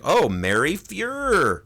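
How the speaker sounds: high, slow dawning realization; hammed-up slow "oh" of discovery; mock realization. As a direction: draw the name out in mock revelation. Retold dawning realization by character.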